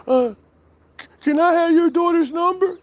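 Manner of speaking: high stuttering caller voice; stammering mock caller. Streamer mocking the nervous troll stammering.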